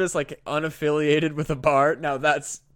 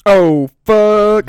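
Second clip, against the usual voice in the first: loud, high burst of alarm; real shock; 6 semitones higher, 13 dB louder than usual. Real, not acted. Hearing the swamp was full of crocodiles, a loud shocked curse. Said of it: "reacting to the giant crocodiles".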